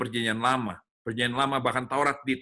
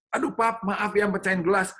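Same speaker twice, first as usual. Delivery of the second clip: little daughter voice; 7 semitones higher than usual. Retold or imitated. Daughter apologizing in story.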